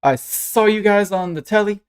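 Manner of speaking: put-on high, drawn-out child voice; excited little girl. Young fan meets the Starfinders, high and excited. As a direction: gush to your idols, stretching the words. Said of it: "she goes".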